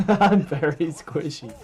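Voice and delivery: said through laughter; laughing. Real, not acted. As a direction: laugh it out slowly. Laughs at own weakness.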